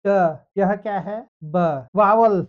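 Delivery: teacher quizzing the class; quiz drill. Shown as a demonstration. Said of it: "जैसे ये क्या है". (शिक्षक का सवाल-जवाब अंदाज़)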